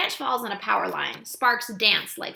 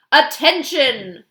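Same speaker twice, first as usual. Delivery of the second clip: loud, slow, drawn-out announcement; loudspeaker call; 6 semitones higher, 12 dB louder, much slower than usual. A narrator's reading. Police officer calling out over the car loudspeaker, loud and slow. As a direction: bark it through a megaphone. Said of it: "announces over a loudspeaker".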